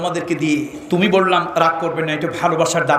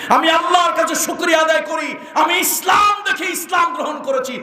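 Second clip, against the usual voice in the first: high, ringing declaration of faith; impassioned preaching; 12 semitones higher than usual. Retold or imitated. Preacher quoting a convert's thanks to Allah, impassioned. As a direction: proclaim it high, like a preacher's climax.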